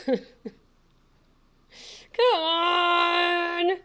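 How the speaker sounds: high, loud gleeful cheering; excited squeal. Real, not acted. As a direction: celebrate like you just won. Finally gets into server.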